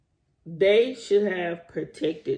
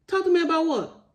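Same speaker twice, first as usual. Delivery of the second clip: incredulous retort; 11 semitones higher than usual. Acted. Repeats retort, more pitched.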